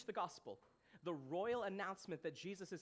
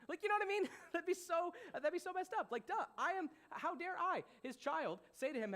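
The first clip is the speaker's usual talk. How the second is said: high, loud, amused outrage; incredulous; 11 semitones higher, 5 dB louder than usual. Acted. Preacher mocking a son who doubts his dad, incredulous. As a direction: exclaim at how absurd it is, pitched way up.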